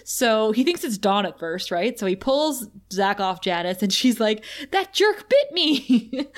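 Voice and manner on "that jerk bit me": slow, high indignant whine, laughing; outraged whine. Pretend. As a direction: whine it like an offended teen. Janice's indignant quote.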